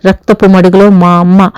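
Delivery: ordinary delivery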